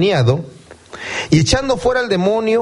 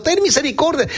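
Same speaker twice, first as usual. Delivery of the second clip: mock cry for mercy; 9 semitones higher than usual. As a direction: imitate blind men calling out from behind. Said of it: "aquellos siguen gritando". (súplica a gritos imitada, mucho más aguda)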